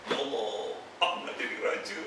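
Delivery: a hushed private plea to God; soft whispered prayer. Shown as a demonstration. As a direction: whisper the prayer, barely voiced. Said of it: "Jangan keras". (doa berbisik perlahan)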